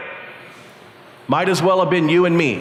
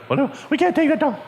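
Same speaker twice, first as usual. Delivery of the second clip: high, fast nervous fretting; panicked disciple; 9 semitones higher, faster than usual. Acted.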